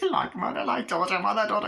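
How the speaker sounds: high, giggly put-on character voice; giggling taunt. Glenda keeps taunting, giggling.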